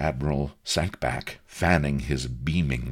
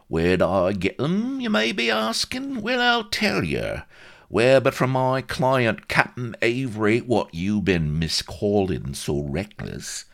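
higher Cockney character voice, making his case; cheeky Cockney agent; 9 semitones higher, 5 dB louder than usual. A narrator's reading. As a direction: argue like an indignant Cockney fixer.

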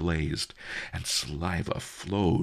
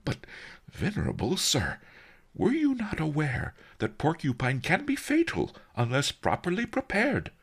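higher-voiced, anxious merchant; lightly voiced worried merchant; concerned merchant; 14 semitones higher than usual. A narrator's reading. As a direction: respectfully query the old master, pitch climbing. Merchant figure questions Master Li.

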